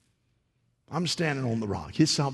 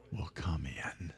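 slow, softer whispered promise; 7 semitones lower, 11 dB quieter, slower than usual. Acted. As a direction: whisper it as a secret. Intimate prayer promise.

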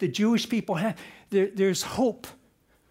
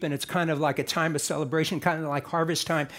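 normal talking voice

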